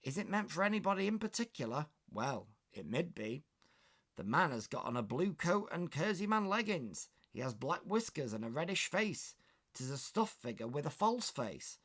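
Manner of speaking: West Country maid. Acted. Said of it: "Trying to do a West Country accent there".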